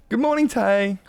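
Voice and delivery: high, cheery hello to a viewer; bright greeting. Real, not acted. Streamer greeting a viewer who just arrived, high and cheery. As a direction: greet her brightly, pitch way up.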